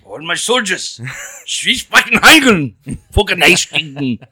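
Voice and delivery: slow loud ranting German dictator; angry German ranting. Pretend. Hitler impression shouted after request.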